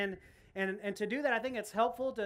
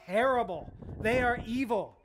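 ordinary delivery

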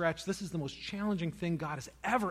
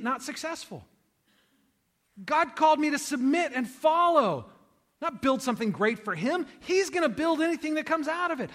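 higher and louder, preaching at full force; impassioned preaching; 9 semitones higher, 7 dB louder than usual. Acted. Pastor urging his congregation, fervent preaching. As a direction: drive the truth home with fervor.

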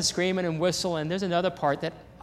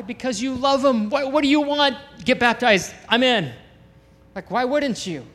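higher and louder, punchy questions; fired-up appeal; 7 semitones higher, 4 dB louder than usual. Real, not acted. Pastor urging the congregation to get baptized, fired-up and higher. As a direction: sell it with joyful disbelief. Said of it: "pastor's own excited appeal".

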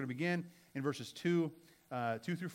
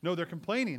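normal talking voice

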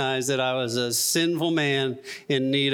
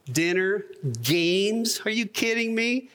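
mock disbelief, each item rising like a question; incredulous; 5 semitones higher than usual. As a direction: recount it as if it's absurd, pitch climbing. His scornful reaction to the invitation.

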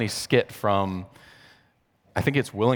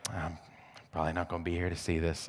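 mock gloomy refrain; 4 semitones lower, 11 dB quieter, faster than usual. Grandmother's refrain imitated.

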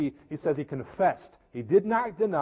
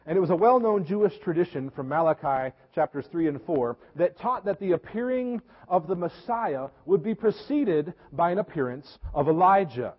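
normal talking voice

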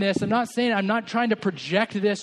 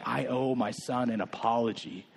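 much lower, much softer, tender; gentle apology to son; 9 semitones lower, 7 dB quieter than usual. Retold or imitated. Father apologizing to his son, tender and hushed. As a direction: kneel down and apologize softly to your boy. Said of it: "I'm thinking back to myself".

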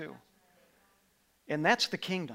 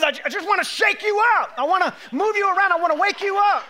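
high, loud shout as God; 14 semitones higher, 12 dB louder than usual. Acted. Pastor voicing God shaking up Israel, loud shout. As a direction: roar it out as the voice of God. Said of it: "And he says".

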